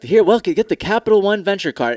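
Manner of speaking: a touch of a celebrity ad read; mock celebrity pitch. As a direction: hype up the card like a star spokesman. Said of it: "Samuel L. Jackson or fucking Charles Barkley comes on".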